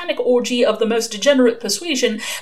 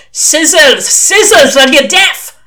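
loud, high, building on the repeat; exasperated shout; 7 semitones higher, 11 dB louder, slower than usual. Acted. Old witchfinder thinks the recruit is deaf, exasperated shout. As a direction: yell it at someone hard of hearing. Exasperated at caller not hearing.

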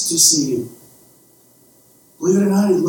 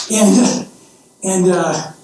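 ordinary delivery

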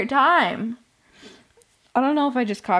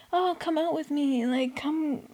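higher-pitched mock coaxing; lightly imitated pleading; 7 semitones higher, 3 dB quieter than usual. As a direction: coax lightly, like a pestering boyfriend. Voices boyfriend coaxing her out.

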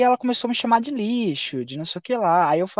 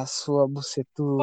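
joking taunt; 6 semitones lower, 6 dB quieter than usual. Retold or imitated. Player teasing his teammate at first, low and soft joking insult. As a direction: let the insult sag into a playful tease. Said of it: "no começo eu tava zoando".

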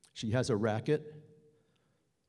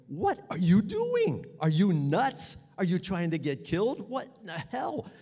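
put-on higher, panicky child voice; scared six-year-old voice; 6 semitones higher, 3 dB louder than usual. Inner six-year-old screaming at him while running, high panicky child. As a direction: let the frightened little boy inside speak. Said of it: "it's a six-year-old voice".

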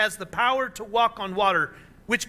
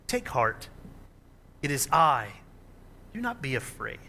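reassuring; 5 semitones lower than usual. Acted. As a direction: settle their fear gently. Jesus calms frightened disciples.

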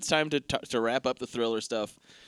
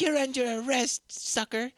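mock cop line; 10 semitones higher than usual. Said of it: "Or what is it?".

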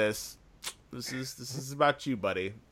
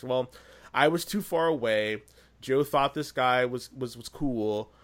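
ordinary talk, nothing marked

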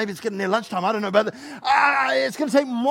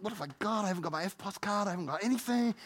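much softer fretting; worried mumble; 8 dB quieter than usual. Acted. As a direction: Philip patting empty pockets. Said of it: "He's like".

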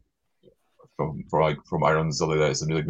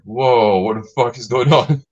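mock shocked; 5 semitones higher, 8 dB louder, slower than usual. Americans shocked at the swearing.

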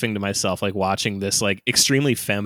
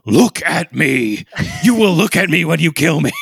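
loud, raised, hammed-up defiant yell; mock warrior shout; 8 semitones higher, 8 dB louder than usual. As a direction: roar it like a crazy warrior prince. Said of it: "suddenly turns from this, like, meek little boy to being like".

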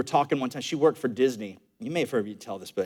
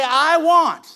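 call and response; 10 semitones higher, 4 dB louder, slower than usual. Shown as a demonstration. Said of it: "Somebody say".

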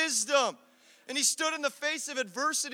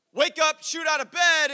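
loud, startled wake-up; jolted awake; 4 semitones higher, 6 dB louder than usual. Retold or imitated. Boy jolted awake by a foghorn, loud and startled. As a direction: burst out of bed in alarm.

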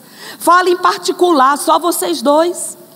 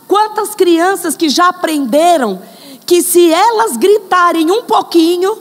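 ordinary delivery

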